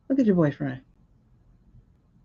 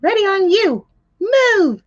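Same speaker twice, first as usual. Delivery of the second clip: higher and louder barked order; mock command; 10 semitones higher, 5 dB louder, slower than usual. Echoing the officer on the clip, a higher, louder mock command. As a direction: mimic the officer yelling go.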